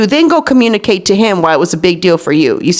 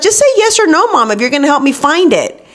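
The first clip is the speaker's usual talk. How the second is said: high-pitched pleading kid, imitated; impatient daughter; 6 semitones higher than usual. Daughter pushes mom for an answer, high impatient mimicry. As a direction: mimic a child pestering mom, voice jumping up high. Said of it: "She's like".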